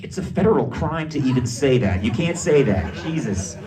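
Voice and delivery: loud mock-panicked reprimand; alarmed scolding. Scolding his daughter in a story.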